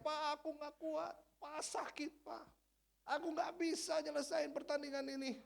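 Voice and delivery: a tearful son crying out to his dad; injured son pleading. Retold or imitated. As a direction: beg your father to let you quit. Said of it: "dia teriak".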